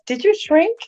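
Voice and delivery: high, surprised mock question; imitating surprised fans. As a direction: ask it like a startled fan. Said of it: "they went".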